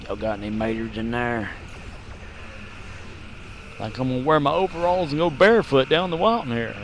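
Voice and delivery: a hint of a Southern drawl; hammy Southern drawl. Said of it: "We're here in the South".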